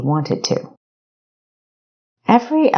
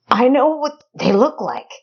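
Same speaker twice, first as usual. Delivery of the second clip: higher, stammering backwoods voice; nervous rustic informant; 10 semitones higher than usual. Acted.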